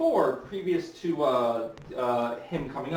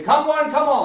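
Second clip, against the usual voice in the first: much higher, loud showman's cry; carnival barker; 14 semitones higher, 10 dB louder than usual. Retold or imitated. Carnival barker calling to the crowd outside, loud high cry. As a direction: bellow it to the passing crowd. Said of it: "So a barker would sit there".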